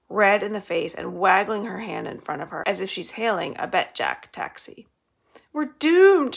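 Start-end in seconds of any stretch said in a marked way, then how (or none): 5.5-6.3 high loud despairing wail. A narrator's reading. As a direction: wail it dramatically. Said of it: "she wails".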